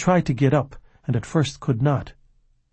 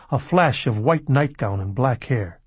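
plain reading voice

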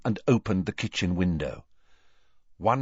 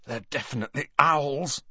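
hoarse, tense whisper-like; hoarse with nerves; 6 semitones higher than usual. Acted. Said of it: "said Ron hoarsely".

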